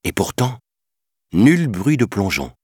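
ordinary delivery

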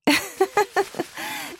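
high little giggle; light laugh. A narrator's reading. Cinderella laughs at the too-tight sweater, high giggle. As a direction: let a delighted laugh slip out. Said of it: "dit-elle en riant".

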